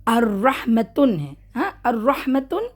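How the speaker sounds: الرحمتن said slowly with the ta and tanween; continuous recitation. Shown as a demonstration. Teacher showing the word read on without a stop, slowly. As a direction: read الرحمتن slowly, -tan ending sounded. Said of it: "دیکھیں".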